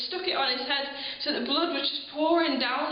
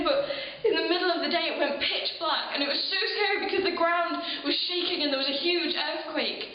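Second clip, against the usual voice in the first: ordinary delivery, nothing marked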